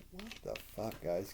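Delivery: soft, under-the-breath dismay; deflated disbelief. Retold or imitated. Reviewer to the show's writers, deflated disbelief. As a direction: drop the volume in weary disbelief. Deflated reaction to copied storyline.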